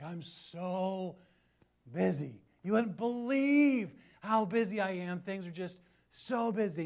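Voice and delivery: mocking a friend, loud and stretched out; exaggerated busy whine. Mocking an old college friend who brags about being busy, hammed-up. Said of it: "like, exaggeratedly".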